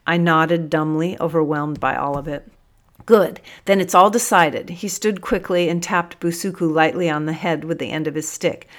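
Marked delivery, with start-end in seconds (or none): 3.1-4.7 decisive. A narrator's reading. Sergeant decisive, louder.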